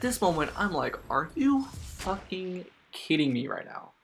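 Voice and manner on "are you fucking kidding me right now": high, slow disbelief; stunned, spaced-out delivery, pitched up; retold incredulity. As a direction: draw it out word by word, incredulous. Retold disbelief at her demand.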